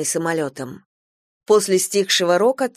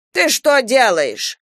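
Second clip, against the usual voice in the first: slow, louder, higher fierce snap; furious grandma; 6 semitones higher, 6 dB louder, slower than usual. Acted. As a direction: bite her head off, slowly. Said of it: "свирепеет бабушка". (бабушка свирепо, медленно и громко)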